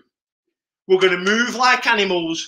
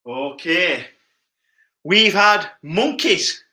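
ordinary talk, nothing marked

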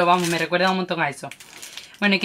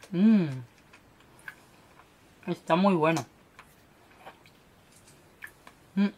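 candy in mouth; talking around a sweet; sucking a hard candy; 5 dB quieter than usual. Real, not acted. Seen 1: Puts blue candy in mouth at 0.6; sucks it, lips pursed afterward.

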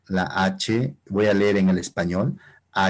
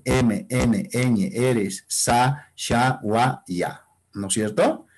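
usual speaking voice

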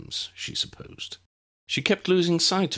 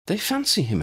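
put-on young girl voice; 13 semitones higher than usual. Tessa explaining to Lydia.

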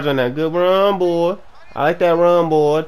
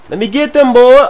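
high yell swelling louder; excited shout; 9 semitones higher, 12 dB louder than usual. Real, not acted. Gamer going for the catch, excited shout. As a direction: holler it as the play opens up.

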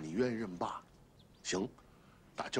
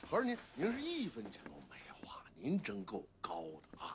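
sarcastic whisper; 7 dB quieter than usual. Acted. Son mocks rival bitterly.

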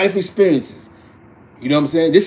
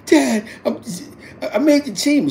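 high imitation of a daughter; daughter's voice; 10 semitones higher than usual. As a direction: report the news to dad in her higher voice. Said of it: "So she like".

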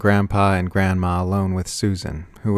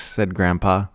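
ordinary delivery

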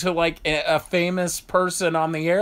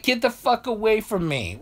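mock woman; 6 semitones higher than usual. Mocking women's reaction.

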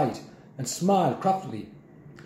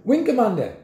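high, louder call with a touch of panic; creeping panic; 9 semitones higher, 5 dB louder than usual. A narrator's reading. Jack calling his grandfather down the corridor, a touch of panic. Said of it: "a note of panic creeping into his voice".

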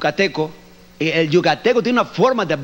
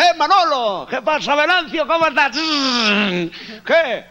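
lightly voiced Spanish Galician accent, higher; Galician Spaniards chatting; 8 semitones higher than usual. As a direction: chat like two cheerful Galicians. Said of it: "Estaba un gallego".

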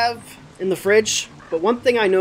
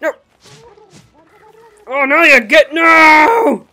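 high, loud desperate no; panicked shout; 7 semitones higher, 10 dB louder, slower than usual. Real, not acted. Player yells at enemy wrecking base, loud panic. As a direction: scream at it to stop.

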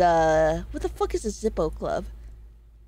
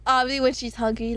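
ordinary talk

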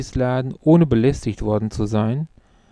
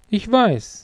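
a higher-voiced friend; Luke; 9 semitones higher than usual. A narrator's reading. Old schoolmate conceding to his friend, higher character voice. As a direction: pitch it up as the knowing friend.

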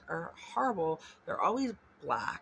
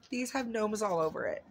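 ordinary talk, nothing marked

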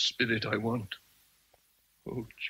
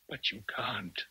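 unvoiced, softer whisper of "But you can't."; whispered plea; 2 semitones lower, 7 dB quieter than usual. Rochester pleading with Jane.

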